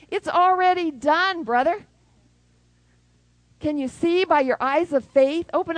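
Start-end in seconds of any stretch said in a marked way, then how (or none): 0.1-1.9 higher, louder triumphant declaration; proclaiming. Acted. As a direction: ring out the promise. God's promise proclaimed.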